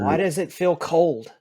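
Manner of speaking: dying son. Acted.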